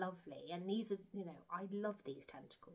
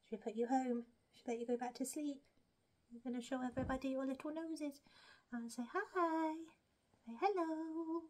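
pet talk; 7 semitones higher than usual. Real, not acted. Coaxing the hamster to greet viewers, high baby talk. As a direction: coo it to a tiny pet.

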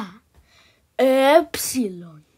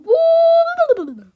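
squeaky high boo; 5 dB louder than usual. Acted.

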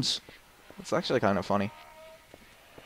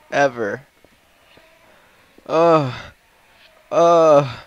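ordinary delivery

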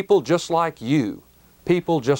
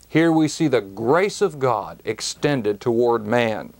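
ordinary delivery

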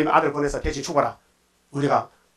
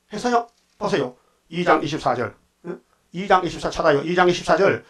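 ordinary talk, nothing marked

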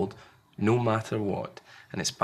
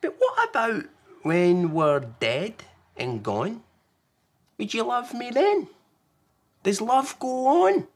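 Small, frightened little one; 13 semitones higher, 5 dB louder than usual. Acted. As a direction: ask the big scary question. Small fearful questions.

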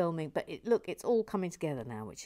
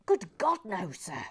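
mock-emphatic denial; 4 dB louder than usual. Host swears the theme stays, mock-emphatic exclamation. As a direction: exclaim it, then settle on "no, sir".

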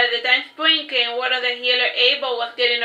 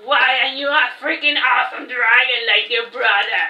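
mock-dramatic, put-on voice; hammed-up character voice. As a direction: ham up the question as a joke. Joking exaggerated redo of Pierre's line.

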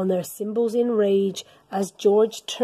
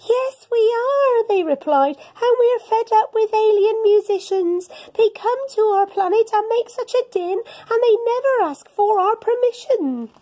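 higher, louder moaning aliens; grumpy Martians; 9 semitones higher, 4 dB louder than usual. Acted. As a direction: complain like fed-up Martians.

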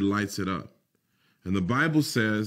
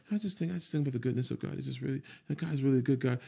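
soft, fast murmur to yourself; quiet self-affirmation; 3 semitones higher, 7 dB quieter, much faster than usual. Retold or imitated. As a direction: murmur the promise under your breath. Positive self-talk after work.

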